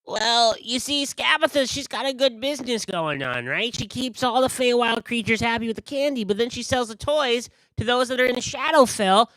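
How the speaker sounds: high, loud conspiratorial explaining; high scheming merchant voice; gossipy carnival figure. Acted. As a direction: let them in on a shady deal.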